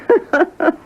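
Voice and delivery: short high-pitched laugh; a quick amused giggle. Real, not acted. Laugh after the quote.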